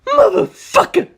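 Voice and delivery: high, slow furious yell. Acted. Doppio yelling at George in fury. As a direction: spit it out in rage. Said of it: "Doppio yelled".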